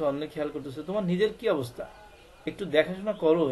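ordinary delivery

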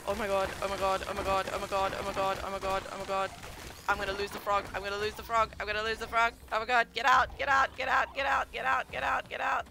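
loud, frantic repetition; panicked. Real, not acted. Player about to lose the frog, loud panic. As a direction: chant it in a real panic, loud and urgent.

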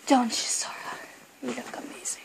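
breathy fangirl whisper; swooning whisper. Pretend. Fan whispering to Sora on screen, swooning hush. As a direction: whisper to him like you're smitten.

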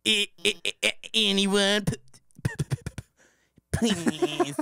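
stuttering, anxious teacher; nervous stammer. Acted. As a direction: trip over every first sound.

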